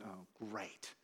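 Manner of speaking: put-on older brother sulks at the welcome-home, mumbled bitterly; resentful older brother. Hushed, bitter imitation of the grumbling older brother. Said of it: "the man is sitting there like Jonah going".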